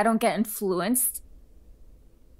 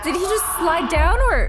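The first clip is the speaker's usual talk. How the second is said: surprised; 6 semitones higher, 6 dB louder than usual. Real, not acted. Surprised by game scene.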